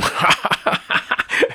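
hearty acted laughter; mocking laugh. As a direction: burst out laughing at your own anecdote. Character mocking, laughs at story. (扮到好好笑咁大笑)

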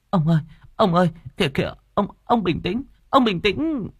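high-pitched, alarmed wife begging him to stop; frantic pleading. A narrator's reading. Wife frantically stops her husband's beating. (hoảng hốt can ngăn)

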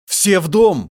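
loud, high urgent shout; father's alarmed yell. A narrator's reading. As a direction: shout the family to safety. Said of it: "крикнул Басиль".

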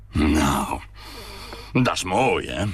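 a touch of bitter sarcasm in the father's voice. A narrator's reading. Father in the taxi home, bitterly sarcastic. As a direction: spit out the praise as bitter irony. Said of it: "zei de vader bitter".

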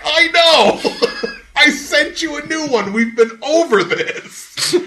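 loud, high, half-laughing exasperation; exasperated shout. Retold or imitated. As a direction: yell it like you've explained it ten times, laughing through it. Exasperated reply to the customer.

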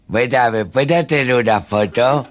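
slow, high wide-mouthed voice; boca grande. Acted. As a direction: talk with the mouth stretched open. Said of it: "hablaba así". (hablando con la boca muy abierta)

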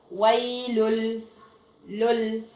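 ويل stretched slowly into a doubled l; merged into lam. Shown as a demonstration. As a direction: say ويل as "waylul", then repeat لل. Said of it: "إدغام بدون غنة".

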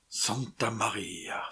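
slow, breathless whisper; whispered exclamation. Acted. José staring at the rock's path, slow awed whisper. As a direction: hush it out in awe. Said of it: "s'écria José".

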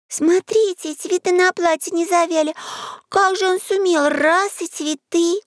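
put-on high-pitched kid voices full of wonder; excited little friends wondering aloud; curious children. A narrator's reading. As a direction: marvel like an amazed child. Children's questions voiced in story.

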